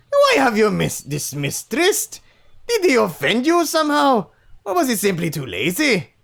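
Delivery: loud, high Scottish attempt; Scottish accent. Chief asking son about Trist, attempted Scottish accent. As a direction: question sternly in attempted Scottish. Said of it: "give him a Scottish accent. I'll try".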